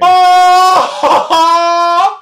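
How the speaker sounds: very high, loud shrieking "oh"; real piercing outburst amid laughter. Real, not acted. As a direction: let out a sudden high scream. Sudden shriek amid group laughter.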